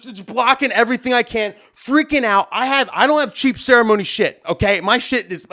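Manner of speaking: ordinary talk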